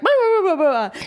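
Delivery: high, loud nonsense tirade; mock ranting. Retelling old woman going at cyclist, mock ranting. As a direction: imitate someone having a real go. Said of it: "really going at me".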